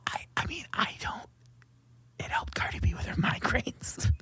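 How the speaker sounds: soft ASMR whisper. Real, not acted.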